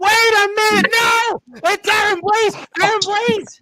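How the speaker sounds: high, loud surprise reveal; excited announcer shout. Acted. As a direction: yell out the big arrival. Host announces surprise arrival.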